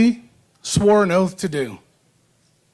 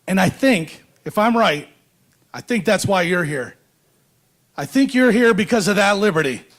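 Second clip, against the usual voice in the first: podium speech. Acted. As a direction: stir up the crowd from the podium. Rousing speech to rally crowd.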